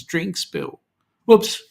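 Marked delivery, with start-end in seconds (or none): 1.3-1.6 loud, high mock oops; a cheeky caught-out yelp; playful whoops. Real, not acted. Caught saying something cheeky.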